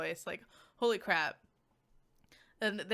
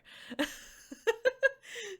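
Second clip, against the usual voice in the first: real laugh; 3 dB louder than usual. Real, not acted. Laughs at own stumble.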